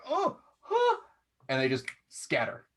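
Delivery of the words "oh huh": high, tiny yelp; tiny startled fae voices; squeaky startled fae. Acted. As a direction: squeak like a frightened sprite. Fae squeak before fleeing.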